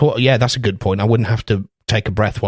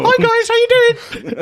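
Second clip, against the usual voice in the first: high-pitched small man. Acted. Man walks up to greet the guys, comically high-pitched voice. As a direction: squeak it out far above your own voice. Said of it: "The deeper the voice".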